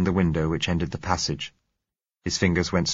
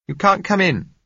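higher, louder woman's call; 13 semitones higher, 6 dB louder than usual. A narrator's reading. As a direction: call it through the door. Josella calls through door.

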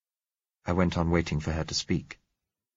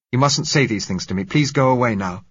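louder, higher, pressed; urgent plea; 6 semitones higher, 7 dB louder than usual. Acted. Narrator begging the girl to leave, louder and higher. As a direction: push her away with urgency.

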